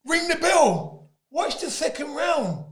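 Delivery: very high disbelief, then slow challenge; indignant defiance. Retold or imitated. Boxer retelling his defiant reaction to the trainer. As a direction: rise to disbelief then dare them.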